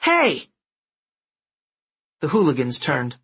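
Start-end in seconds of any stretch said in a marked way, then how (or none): 0.1-0.5 sharp high yell. Acted. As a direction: shout to stop them. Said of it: "a voice yelled".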